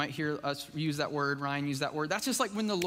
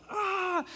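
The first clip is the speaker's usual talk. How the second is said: high, sudden exasperated "Ah!"; preacher voicing her Tuesday frustration, a high yelp; frustrated cry; 13 semitones higher than usual. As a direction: let out a burst of overwhelm. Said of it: "I'm like".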